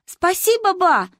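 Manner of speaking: put-on high, loud childlike cry; high-pitched children's shout to grandma; children shouting thanks. A narrator's reading. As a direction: call it out like kids in chorus. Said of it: "хором закричали мы".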